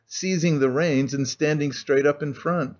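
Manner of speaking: plain reading voice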